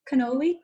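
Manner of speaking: cannoli in full with "ee" ending, questioning rise; American cannoli. Shown as a demonstration. Guest checks how it is said, cannoli the American way, as a question. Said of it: "That's American".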